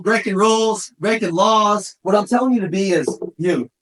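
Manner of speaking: ordinary talk, nothing marked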